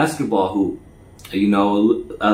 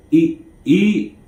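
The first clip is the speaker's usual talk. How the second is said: higher, urging eat eat; insistent urging; 7 semitones higher than usual. Retold or imitated. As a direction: press him to eat. Quoted insisting, first take.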